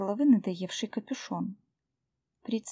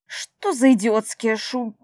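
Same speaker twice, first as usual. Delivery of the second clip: loud angry shout cut off; barking; 9 dB louder than usual. Acted. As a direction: bark then break off. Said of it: "рявкнул Митиус".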